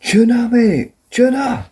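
high-pitched mock Scottish accent; hammed-up Scottish cry; Joe Hendry. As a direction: squeal it in exaggerated Scottish, drawn-out vowels. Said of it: "see what he says".

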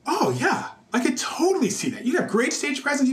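high, easygoing imitation of Keith; friend's reply. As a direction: toss it off high and casual. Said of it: "he was like".

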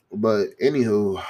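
ordinary talk

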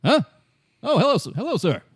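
put-on high, startled old gent; an old explorer caught off guard; old scientist voice. Old Doctor Morell greets stranger, high startled voice.

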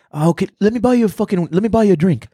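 high, quick voice of a welcoming local; imitated eager offer. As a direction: play the stranger suddenly keen to treat you. Acts out locals eagerly offering drinks.

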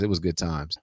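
soft nostalgic repeat; quiet fond echo. Real, not acted. Fond echo of his own line.

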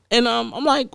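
usual speaking voice

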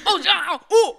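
high, loud wordless umpire call; Tusken Raider-like yell. Retold or imitated. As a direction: holler like a garbled umpire. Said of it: "You sounded like a Tusken Raider".

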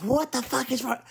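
high-pitched mock outrage; angry driver. Storyteller imitating the old man waving a finger, high mock outrage.

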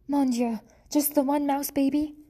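a French mother mouse gasping at bad news; French accent. French mother mouse dismayed at a single baby, French accent. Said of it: "She was a French mouse".

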